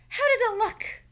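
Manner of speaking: high, loud eager question; Franklin's voice. Acted. Franklin asking Beaver, high and loud.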